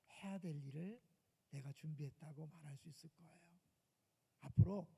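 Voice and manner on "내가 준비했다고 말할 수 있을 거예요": soft whispered answer. Acted. Preacher whispering the answer to the youth, hushed. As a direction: breathe the answer as a secret.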